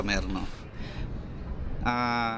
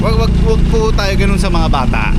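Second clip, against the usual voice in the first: louder, pleading; loud plea; 6 semitones higher, 10 dB louder than usual. Real, not acted. Rider pleading loudly with parents to stop yelling at kids. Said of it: "pleading with parents, loud".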